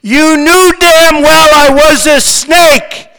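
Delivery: loud, high, slow recited taunt; grinning scorn. A narrator's reading. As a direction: deliver the snake's punchline at full volume. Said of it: "said the reptile with a grin".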